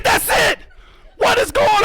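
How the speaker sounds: very high, strained exclamation; hammed-up high-pitched astonishment; amazed outburst. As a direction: squeal it in amazed disbelief. Retelling amazed reaction to osprey.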